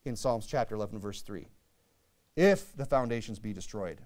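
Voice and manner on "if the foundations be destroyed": "If" hit hard, then the rest falls away; stress on IF. Shown as a demonstration. Preacher showing what he's getting at, stress on "If". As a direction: punch the word "If" at the start. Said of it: "I put an emphasis on the word".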